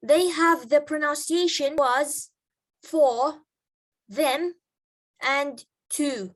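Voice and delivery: was, for, them, to, each said in full; strong forms. Shown as a demonstration. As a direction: say "was" "for" "them" "to" one by one in their strong form. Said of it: "If those words are pronounced alone". (الكلمات بالصيغة القوية)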